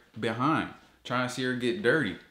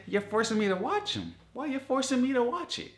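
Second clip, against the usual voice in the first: very high, incredulous complaint; exasperated rant; 14 semitones higher than usual. Real, not acted. Vlogger protesting ads forced on him, exasperated high rant. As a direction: pitch it up in disbelief.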